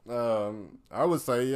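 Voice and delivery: ordinary delivery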